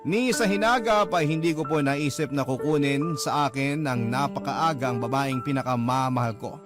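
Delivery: normal talking voice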